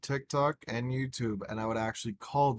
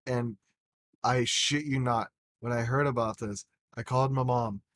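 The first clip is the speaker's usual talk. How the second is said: ordinary talk, nothing marked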